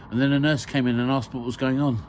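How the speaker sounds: ordinary delivery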